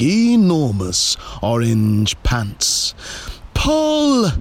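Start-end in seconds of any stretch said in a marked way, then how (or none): 3.5-4.3 high, drawn-out command; rallying child's cry; calling out. A narrator's reading. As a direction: call it high to rally the team. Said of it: "called Polly".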